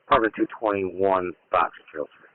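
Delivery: low tinny radio voice; police radio. Real, not acted. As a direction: sound like it comes through a scanner. Readback over radio channel.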